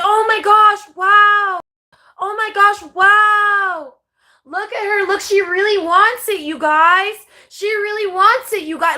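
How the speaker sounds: hammed-up fake awe at her; mocking gushing. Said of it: "We're like".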